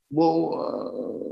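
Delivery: ordinary talk, nothing marked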